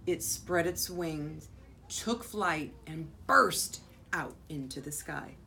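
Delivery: dramatic storybook climax with drawn-out phrases; story narration. A narrator's reading. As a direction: read the big moment to children, strong then hushed at the end. Reads picture book aloud to children.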